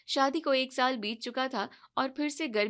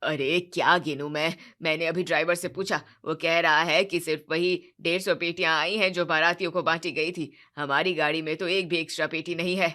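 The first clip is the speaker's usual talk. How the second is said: low, loud and quick complaint; annoyed father-in-law; 6 semitones lower, 5 dB louder than usual. Acted. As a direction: lower voice, irritated and fast. Sharma ji reports only 150 boxes.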